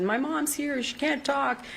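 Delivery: put-on high-pitched retelling of a distraught child; imitating hysterical crying. Crying niece tells aunt that mom can't talk, imitated distress. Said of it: "she was just crying hysterically".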